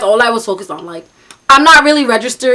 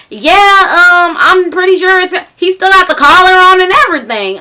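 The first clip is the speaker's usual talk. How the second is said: higher, louder mimicked country caller; thick country accent; 7 semitones higher, 5 dB louder than usual. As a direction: put on a loud country twang. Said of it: "talking in this thick country accent".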